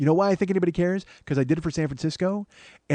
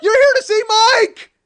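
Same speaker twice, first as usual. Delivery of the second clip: high, loud hammed-up yell of his own name; mock indignant shout; 9 dB louder, slower than usual. Comedian mocking his own ego to the audience, hammed-up shout. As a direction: bellow it like a wounded star, laughing through it.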